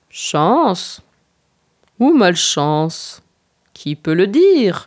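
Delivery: slow, drawn-out doubtful grumble; grumbling. Acted. Ours ponders after the feast, slow mild grumbling. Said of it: "grommela Ours après quelque temps".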